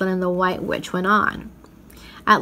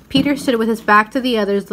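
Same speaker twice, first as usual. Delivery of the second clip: plain reading voice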